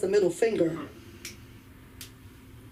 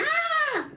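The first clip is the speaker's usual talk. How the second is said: shrill mock scream, way up high; startled yelp, pitched very high. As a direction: shriek in fright like waking a coma patient. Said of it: "I screamed".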